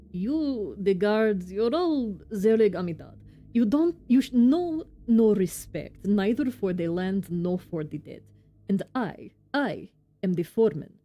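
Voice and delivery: put-on indignant foreman with amateur Russian accent; bad Russian accent. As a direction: protest in a clumsy Russian accent. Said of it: "my very bad Russian accent".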